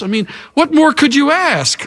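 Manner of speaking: high-pitched, defensive rhetorical question; protesting King Saul. Acted. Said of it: "Look at that resistance to accountability".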